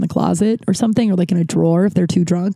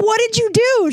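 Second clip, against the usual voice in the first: much higher, incredulous question; mock-shocked squeal. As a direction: replay your own disbelief. Retelling her own shocked reaction.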